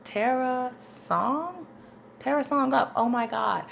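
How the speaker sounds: mock confusion turning to realisation; puzzled sounding-out. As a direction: puzzle over the name, then get it. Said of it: "because I'm like".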